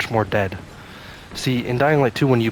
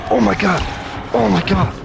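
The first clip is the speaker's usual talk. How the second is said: startled scream; 6 semitones higher than usual. Real, not acted. Gamer jumped by an unseen monster, screaming in alarm. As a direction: yelp in real fright, then settle slightly. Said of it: "as I just scream".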